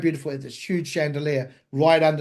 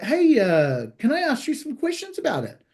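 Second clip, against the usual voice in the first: higher-pitched, lightly voiced American; mock American accent; 9 semitones higher than usual. The DJ asking for advice, mock American accent. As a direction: imitate an American making a keen request. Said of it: "this annoying American".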